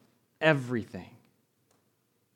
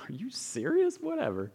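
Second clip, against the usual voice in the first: scoffing disbelief; 11 semitones higher than usual. Retold or imitated. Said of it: "They're like".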